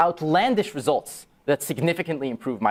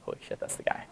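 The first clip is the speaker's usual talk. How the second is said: whisper; 12 dB quieter than usual. Retold or imitated. Spotting the laptop thief.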